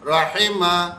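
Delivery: "راحمہ" as "ra-hay-ma"; "راحمہ" with an "ay" middle vowel; wrong majhool vowel. Shown as a demonstration. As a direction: show the mistake in "راحمہ" with "hay". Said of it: "یوں نہیں پڑھیں گے".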